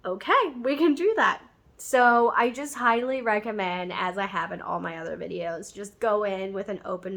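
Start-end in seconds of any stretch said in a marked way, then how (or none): 0.0-1.4 higher, louder mock waiter reply; imitated waiter. Waiter answering her request for the Hungarian way, imitated in a raised voice. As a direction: lift the voice up for the agreeable waiter. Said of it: "the waiter was like".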